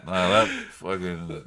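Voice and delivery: slow, dragged out through laughter; laughing. Real, not acted. Cohost laughing off the teasing about his pick. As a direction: protest while laughing.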